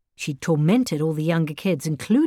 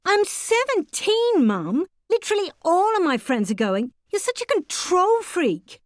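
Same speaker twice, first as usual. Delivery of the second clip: put-on indignant teenager; 13 semitones higher than usual. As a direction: protest like a fed-up teenager. Teen daughter protesting.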